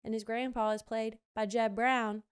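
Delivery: usual speaking voice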